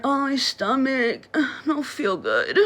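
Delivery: slow, soft, whiny moan over a sore stomach; mock whining. Mocking her sister's attention-seeking stomach complaints, whining. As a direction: put on a weepy poor-me voice, drawn out. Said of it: "moaning and whining".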